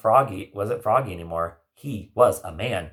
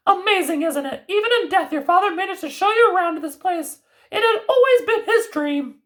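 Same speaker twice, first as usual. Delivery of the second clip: much higher, louder kindly grandmother; high fairy-tale granny voice; 5 dB louder than usual. Acted. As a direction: play the knowing old grandmother. Grandmother character voice.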